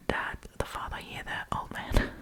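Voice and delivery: ordinary talk, nothing marked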